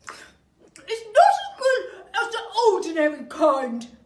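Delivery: loud, slow, chewing through food; mouth full. Acted. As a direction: talk through a mouthful of spaghetti. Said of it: "talking with his mouth full".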